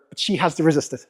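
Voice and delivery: ordinary talk, nothing marked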